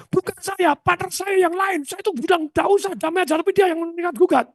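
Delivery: high put-on denial; high mock protest from the partner; retold denial. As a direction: deny blame in a high voice. Partner voiced in story.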